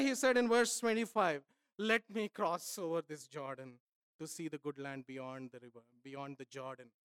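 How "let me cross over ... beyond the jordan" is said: much lower and much softer, hushed; a quiet, intimate plea; soft pleading. Acted. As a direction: let the verse fall away to a hush. Moses' plea read softly.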